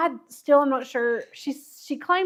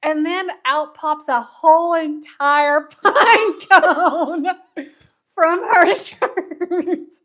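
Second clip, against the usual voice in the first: loud telling broken up by laughter; laughing while talking; 4 semitones higher, 10 dB louder, slower than usual. Real, not acted. Friend tells of the pine cone popping from her shirt, laughing. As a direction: struggle to get the words out through laughter.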